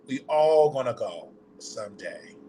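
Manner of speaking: ordinary delivery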